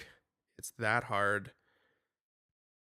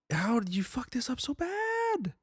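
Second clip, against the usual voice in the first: exasperated; 14 semitones higher than usual. Real, not acted. Exasperated rant about bad trailer.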